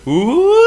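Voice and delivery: high eerie moan; ghostly moan. A narrator's reading. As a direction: wail like a ghost. Eerie moaning sound.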